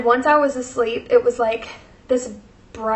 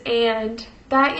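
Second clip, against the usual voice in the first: ordinary delivery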